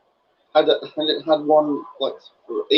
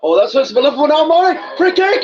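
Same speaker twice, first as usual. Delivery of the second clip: high, fast, louder sudden outcry; excited outburst; 9 semitones higher, 4 dB louder than usual. Real, not acted. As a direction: blurt it out, fired up. Excited at a foul on the pitch.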